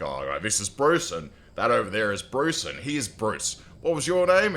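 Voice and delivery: jovial Aussie greeting a newcomer; Australian accent. Retold or imitated. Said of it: "the Monty Python sketch with the Australians".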